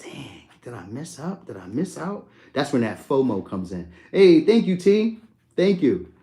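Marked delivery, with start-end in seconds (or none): 0.0-2.3 softer and slow, second-guessing; retelling his regret after leaving too early, quiet self-doubt. Retold or imitated. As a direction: ask yourself under your breath if you blew it. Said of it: "I'll be kinda like".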